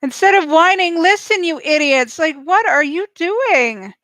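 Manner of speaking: yelling at the screen in frustration; exasperated scolding. Real, not acted. Scolding the teams on TV.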